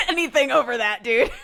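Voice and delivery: real laughter breaking in; laughing. Real, not acted. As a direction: crack up while talking. Laughing while telling the story.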